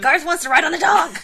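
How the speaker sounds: high, loud, laughing announcement; excited laughing exclaim. Real, not acted. Cohost showing the comic panel, excited and laughing. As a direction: burst out with the news, giggling.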